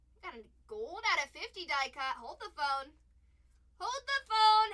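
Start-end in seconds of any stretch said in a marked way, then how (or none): 3.8-4.7 higher, much louder call-out; excited call. Real, not acted. Yelling to the stream as a gold card appears. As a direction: shout it as the big card shows up.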